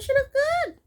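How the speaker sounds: high, loud gushing approval; excited praise. Real, not acted. Viewer gushing over bride's dress, high excited praise. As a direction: gush over how she looks.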